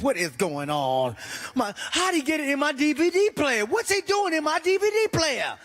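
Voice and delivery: mock horror at the mess; disgusted shock. Discovering poop in player.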